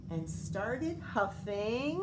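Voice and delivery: ordinary delivery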